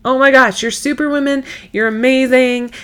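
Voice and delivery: high, loud cheerleader gush; gushing praise. Pretend. Cheering on a mom having a great day, excited gush. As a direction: hype yourself up like a fan.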